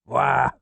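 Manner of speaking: father's angry wordless bellow. A narrator's reading. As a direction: let out a furious roar. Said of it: "he bellows".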